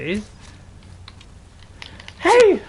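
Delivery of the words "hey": high, loud, drawn-out protest; outraged gamer yelling at the enemy; indignant shout. Pretend. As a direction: yell at an attacker to leave your companion alone. Shouting at enemy attacking follower.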